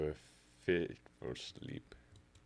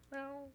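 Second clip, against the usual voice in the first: very high mewing meow; a tiny cat's meow, way up high; happy kitty meow; 4 dB quieter than usual. Acted. Cat meows happily.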